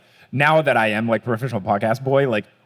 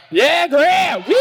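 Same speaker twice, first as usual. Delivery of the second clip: high, loud cheer with a whoop; 4 dB louder, much slower than usual. Real, not acted. Host hypes the crowd for a friend, cheering.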